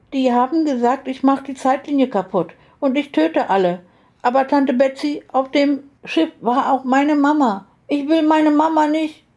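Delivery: put-on high, pleading child voice; upset little girl. A narrator's reading. Little girl tells her aunt what they said, distressed.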